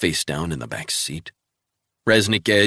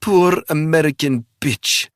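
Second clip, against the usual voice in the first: put-on high, loud Russian-accented sneer; cackling contempt in Russian accent; Russian villainess mocking; maniacal Russian spy; 10 semitones higher, 6 dB louder than usual. A narrator's reading. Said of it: "She laughed like a maniac".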